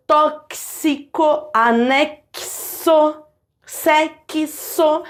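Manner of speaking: TÓXICO, ANEXO, SEXO slowly split on the k-s. Shown as a demonstration. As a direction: break each of TÓXICO, ANEXO, SEXO at a crisp k then s. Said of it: "Você tem esse som de X".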